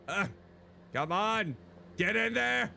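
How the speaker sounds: frustrated shouting. Real, not acted. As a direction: shove the words out in frustration. Forcing piece into slot.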